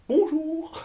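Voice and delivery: very high, sing-song mock bonjour; breezy intruder. Man walking in on a comedian backstage, breezy mock greeting. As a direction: chirp a hello like an oblivious visitor. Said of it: "Et genre, genre l'air de rien".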